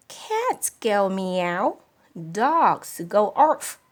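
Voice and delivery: slow, deliberate explaining; slow teaching. Acted. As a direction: spell it out slowly for a child. Mother teaching slowly.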